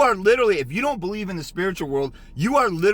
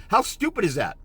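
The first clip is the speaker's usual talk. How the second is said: normal talking voice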